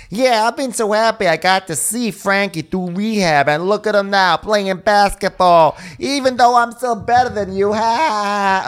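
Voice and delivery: high, loud, far-off mocking jock voice; squeaky bragging gym-bro send-up, played for laughs; mock bro therapist. Therapist bragging to the rehab kid, in a high mocking voice. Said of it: "And he's like".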